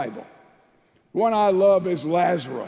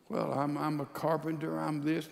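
softer, lower, fumbling reply; hesitant mock answer; 4 semitones lower, 9 dB quieter than usual. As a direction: answer unsurely in a small, low voice. Acted hesitant answer to the question.

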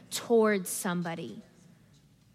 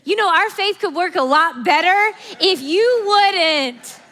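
laughing mock rebuke; 8 semitones higher, 10 dB louder than usual. Mocking a pious rebuke.

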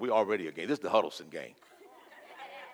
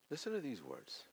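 hushed call; 16 dB quieter than usual. Acted. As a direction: call them in quietly.

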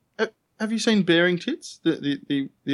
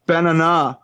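"Banana" with the last syllable punched loud; wrong-syllable stress; 6 dB louder, slower than usual. Shown as a demonstration. Answering a friend's challenge, stress moved in "Banana". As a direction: say "Banana" as ba-na-NAH, loud ending. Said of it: "put the emphasis on the wrong syllable".

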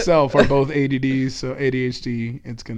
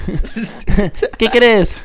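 higher, louder hammed-up Spanish, laughing; playful Spanish accent; 6 semitones higher, 5 dB louder than usual. Offering co-hosts something Spanish after the failed accent, mock Spanish voice. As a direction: throw out a jokey Spanish phrase. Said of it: "If you want something Spanish".

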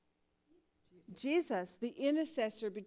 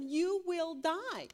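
slow, drawn-out solemn warning; ominous God voice; 5 semitones higher, much slower than usual. Retold or imitated. Teacher voicing God's warning to the grumblers, slow and grave. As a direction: spell out doom word by word. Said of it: "God has already told them".

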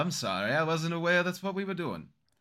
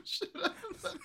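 higher, breathless laughing read of the poster line; voiceless giggling poster caption; laughing whisper; 8 dB quieter, faster than usual. Pretend. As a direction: squeeze it out between laughs. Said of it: "it just says at the bottom".

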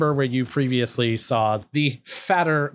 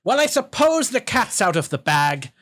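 higher, projected goblin villain voice; raised-pitch theatrical goblin call; yelling up; 8 semitones higher than usual. Acted. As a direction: call up to hidden intruders. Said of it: "he yells up".

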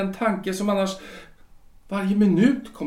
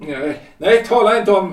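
urgent protest; 8 dB louder than usual. Acted. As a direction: shout her down to stop the subject. Husband cuts off painful subject.

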